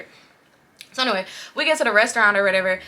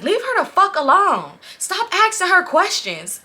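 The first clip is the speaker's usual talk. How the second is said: high, loud, drawn-out telling-off; mock angry scolding; 10 semitones higher, 4 dB louder, slower than usual. Boyfriend telling her to stop asking questions, mock angry scolding. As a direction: imitate a man slowly scolding, pitched way up.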